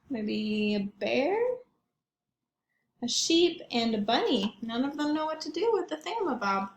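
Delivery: ordinary delivery, nothing marked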